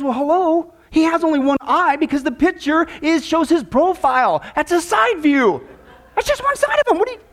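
high-pitched flustered correction, slowing on the key words. Acted. Policeman answering a silly detective candidate, flustered. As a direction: explain the obvious, climbing to a squeak of disbelief. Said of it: "he's a little flustered at Wes's ridiculous response".

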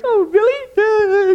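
high "Oh, really?"; surprised guest. Retold or imitated. Guest husband mock-surprised at the flowers, high.

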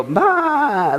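a high, jokey sheep bleat; a quick mock baa like a sheep. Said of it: "we could all join together and go".

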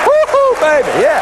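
squealing wife whooping with joy; gleeful whoop. Retold or imitated.

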